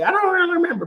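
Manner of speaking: high mock voice, airy dismissal; mock wife. Imitating his wife's vague answer, high mock voice. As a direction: lightly play the wife brushing it off. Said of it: "wife's reply retold".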